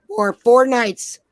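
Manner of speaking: For four nights, slow and loud, leaning on four; stress on four. Real, not acted. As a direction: drag out "For four nights" with weight on four. Stressing the cheap deal.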